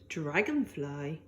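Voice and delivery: a slow, hushed introduction of the dragonfly; slow soft announcement. A narrator's reading. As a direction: draw the name out softly. Announcing next creature.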